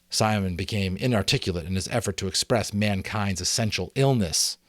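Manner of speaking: ordinary delivery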